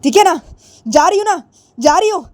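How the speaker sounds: loud, high snap back; angry retort. Acted. Jiya answering her father as she leaves, loud and upset. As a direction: storm off, fine I'm going. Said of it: "तो जिया बोलती है".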